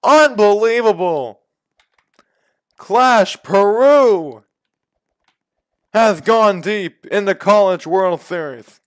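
screaming high home run call, voice breaking; voice-cracking home run call. Acted. Gamer calling the go-ahead homer, voice cracking. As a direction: scream it like a walk-off announcer. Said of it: "My voice just cracked during that call".

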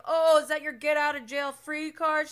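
mocking Sandoval. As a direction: throw the taunt back smugly. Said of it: "he's like".

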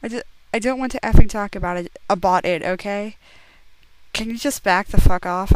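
fast, loud angry rant; hammed-up teen fury, rushed and loud; angry outburst. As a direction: snap at him in a quick hammed-up fury. Heroine snaps at Edward.

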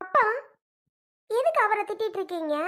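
high, indignant question; upset protest. Acted. Daughter confronts her father over the scolding, high indignant.

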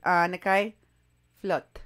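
"Anakai" as AH-na-kai, then "Flot" in one syllable; "Anakai" lifted at the end, "Flot" falling; imitated name pronunciation. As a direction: show how she says "Anakai Flot". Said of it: "She pronounces the last name Flot".

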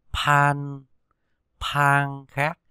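pan read with a strong puff of air on the p; aspirated pan. Shown as a demonstration. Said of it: "thì đọc là pan". (đọc pan bật hơi rõ)

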